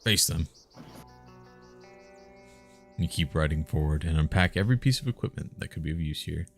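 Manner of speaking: ordinary talk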